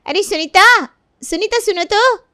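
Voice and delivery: high-pitched call to someone passing. Acted. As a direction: call out to catch her attention. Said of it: "Kaveri Sunita को आवाज़ देती है". (आवाज़ देकर बुलाना)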